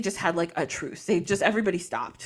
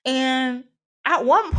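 usual speaking voice

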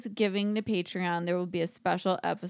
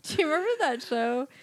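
ordinary talk

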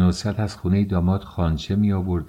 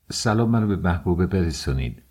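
ordinary delivery

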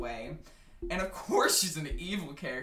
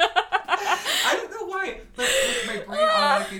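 laughing; 14 semitones higher, 9 dB louder than usual. Real, not acted. Laughing through an admission.

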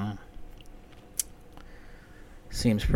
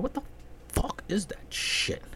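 disgusted whisper; 11 semitones higher, 6 dB quieter than usual. Real, not acted. Disgusted aside.

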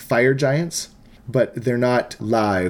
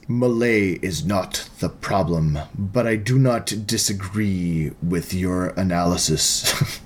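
deep devil voice; 5 semitones lower than usual. Acted. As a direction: speak as an ancient devil granting favors.

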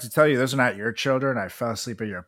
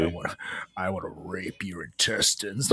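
low, hushed menacing caller; quiet mock-sinister phone voice; creepy caller voice; 8 semitones lower, 9 dB quieter, slower than usual. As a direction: drop deep and soft like a creepy caller. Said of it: "that's the voice I hear in my phone calls".

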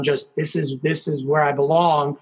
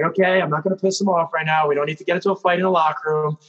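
normal talking voice

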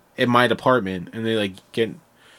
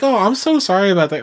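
apologetic customer; 9 semitones higher, 4 dB louder, faster than usual. Retold or imitated. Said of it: "They're like".

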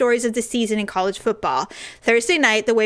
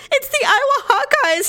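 mock tearful husband. Retelling husband Daryl crying.

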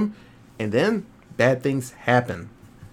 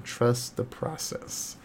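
"Trust the process" slow and much softer, like a creepy mantra; ominous mantra; 10 dB quieter, much slower than usual. Shown as a demonstration. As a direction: intone "Trust the process" slowly and softly, like a cult motto. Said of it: "that is said many a time".

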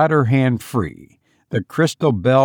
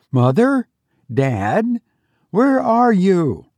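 lightly voiced boy, pitched higher; clear youthful voice; 7 semitones higher than usual. A narrator's reading. Son calling parents from darkness below, youthful. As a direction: call questioningly in a light youthful voice. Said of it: "youthful voice".

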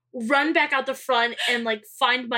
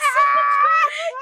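shrieking laugh; 14 semitones higher, 7 dB louder than usual. Real, not acted. Friends cracking up.

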